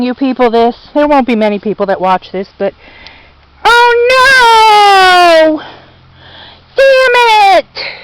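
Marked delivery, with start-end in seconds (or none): 3.6-8.0 sudden high, loud cry of dismay; dismayed outcry. Real, not acted. Gardener finding her cantaloupe eaten cries out in dismay. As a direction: gasp it out as the ruined crop comes into view. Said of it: "Sorry for that little rant".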